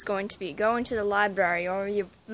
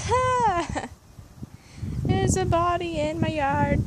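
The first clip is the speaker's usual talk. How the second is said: movie character; 5 semitones higher, slower than usual. Retold or imitated. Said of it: "like Funny Farm".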